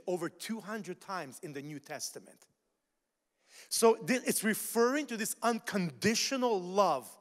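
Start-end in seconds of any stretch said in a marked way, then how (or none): none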